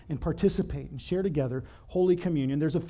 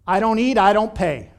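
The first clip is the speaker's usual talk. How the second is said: louder, defiant imitation of a boy; mad little boy; 3 semitones higher, 8 dB louder than usual. Boy refusing the usher's offering basket, mad and defiant. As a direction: push it out loud as an indignant kid. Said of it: "He is so mad".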